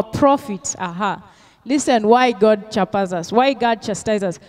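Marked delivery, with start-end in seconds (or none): none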